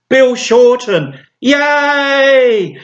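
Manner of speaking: high, drawn-out winner announcement with a cheer; mock celebration.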